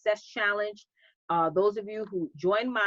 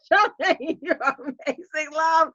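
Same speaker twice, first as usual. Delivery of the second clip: laughing; 5 semitones higher, 4 dB louder, slower than usual. Real, not acted. Laughing praise of a viewer.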